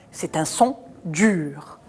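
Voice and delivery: usual speaking voice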